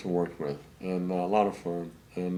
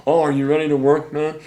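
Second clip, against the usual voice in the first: called-out question; 6 semitones higher, 8 dB louder than usual. Retold or imitated. Calling to George through door.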